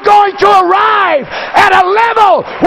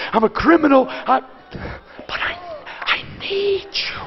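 much softer, hushed desperate plea; under my breath; 4 semitones lower, 7 dB quieter than usual. Retold or imitated. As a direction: pray it barely above a breath. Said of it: "under my breath".

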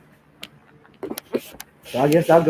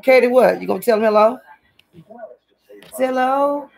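higher, sing-song pet talk; talking up to her little dog; 7 semitones higher than usual. Real, not acted. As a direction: coo at the dog in a raised voice.

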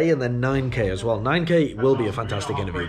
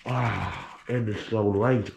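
normal talking voice

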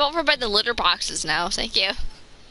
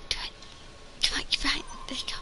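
whispering; 11 dB quieter, much faster than usual. Real, not acted. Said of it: "Why are you whispering?".

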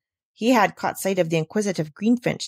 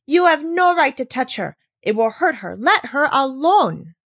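higher, louder indignant protest; indignant cry; 5 semitones higher, 4 dB louder than usual. Acted. Girl defending the goat against Peter, indignant cry. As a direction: stand up to him, outraged. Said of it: "cried indignantly".

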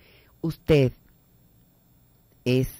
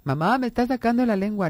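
higher whiny Mamá, me está sacando la lengua; tattling child; 6 semitones higher than usual. Retold or imitated. Child tattling to mother about his brother, whiny. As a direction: stretch it like a child telling on a sibling.

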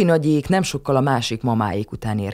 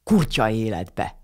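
hasty correction; 3 semitones lower, slower than usual. A narrator's reading. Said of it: "gyorsan helyesbített".

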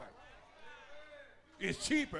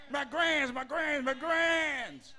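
preaching at full force; 5 semitones higher than usual. Real, not acted. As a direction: hammer each pressure out like a hellfire preacher.

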